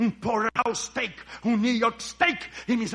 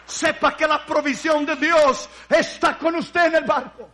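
louder, higher exhortation; fervent sermon voice; raised preaching; 7 semitones higher, 9 dB louder than usual. Acted. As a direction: keep preaching with fervor.

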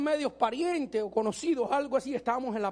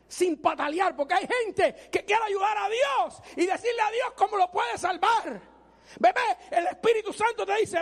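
much higher, shouted sermon climax; fiery preaching; 9 semitones higher, 4 dB louder than usual. Acted. Preacher warning people who try to help God, shouting. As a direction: preach it at the top of your voice.

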